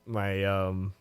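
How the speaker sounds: ordinary talk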